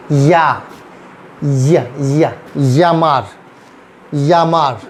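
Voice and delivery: "या" and "यामार" with a y glide for double L. Shown as a demonstration. Teacher showing how double L sounds, like Y. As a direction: slowly model "यामार" starting on y, falling tone. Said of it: "वाई की तरह".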